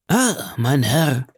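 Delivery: mild, drawn-out wail to a gentleman; exclaiming. A narrator's reading. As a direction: draw out the exclamation in dismay. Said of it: "rief der Lucchese".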